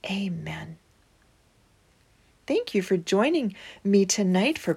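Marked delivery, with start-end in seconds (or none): none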